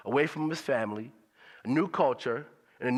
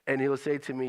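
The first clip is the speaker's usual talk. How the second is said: ordinary talk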